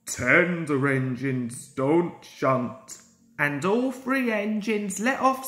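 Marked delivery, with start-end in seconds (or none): 0.1-3.0 slow and haughty, lower pitch; rude, lower. Acted. Gordon lecturing the boss, lower, slow and haughty. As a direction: lay down the law like a proud engine. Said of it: "said Gordon rudely".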